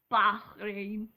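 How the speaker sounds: throaty Scouse slip on Bahrain; accidental Scouse accent. Real, not acted. Vlogger mispronouncing a circuit name, accidentally Scouse accent. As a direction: let it come out Scouse. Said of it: "That was really Scouser".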